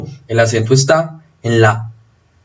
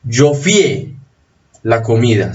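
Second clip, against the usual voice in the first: fié in one syllable, weight on the é; "fié" said fyEH, gliding quickly off the i; stress on the E of fié. Shown as a demonstration. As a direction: punch the final vowel of "fié". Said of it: "el acento está en la abierta".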